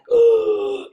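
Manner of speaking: sharp loud gasp of shock; big shocked intake of breath. Retold or imitated. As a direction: gasp as if caught off guard. Everyone shocked at late arrival.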